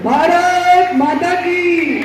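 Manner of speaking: high, drawn-out slogan call; rallying slogan. Real, not acted. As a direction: call out to the crowd for their answer. Leads crowd in patriotic slogan. (ऊँची आवाज़ में खींचकर जयकारा)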